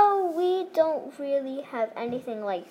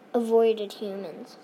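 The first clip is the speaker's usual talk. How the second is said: ordinary talk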